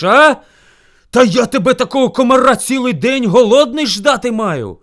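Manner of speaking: loud, high, slowing indignant roar; angry bear yell. A narrator's reading. Starving bear rages at hare, angry yelling. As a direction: bellow at a tiny offender. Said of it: "почувши ведмежий крик".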